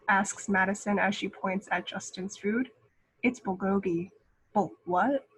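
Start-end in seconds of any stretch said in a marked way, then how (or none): none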